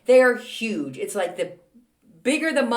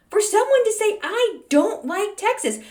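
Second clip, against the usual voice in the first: indignant; 12 semitones higher than usual. Acted. Vlogger accused of disliking Texas, indignant. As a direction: protest in theatrical offence. Outraged at viewer's comment.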